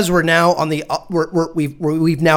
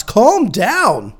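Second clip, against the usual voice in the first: slow exasperated plea. Retold or imitated.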